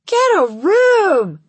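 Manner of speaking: loud, slow, high jeer; calling out. Acted. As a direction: call it out across the crowd. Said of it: "another classmate calls out".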